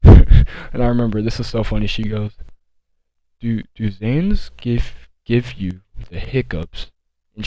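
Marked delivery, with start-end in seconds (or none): 3.4-6.9 very slow, dragged-out question; imitating a sedated girl asking slowly; mock slow dazed talk. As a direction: stretch every word like your brain is lagging. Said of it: "she's talking slow".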